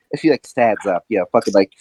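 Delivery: normal talking voice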